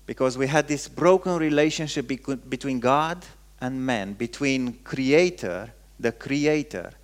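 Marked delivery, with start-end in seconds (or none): none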